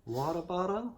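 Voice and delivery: high, questioning American-accented try; American accent attempt. Shown as a demonstration. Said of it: "your American accent".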